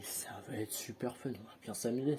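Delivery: ironic whisper. Real, not acted. 95 stickers to stick.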